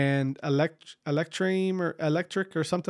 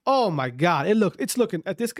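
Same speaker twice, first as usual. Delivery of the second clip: normal talking voice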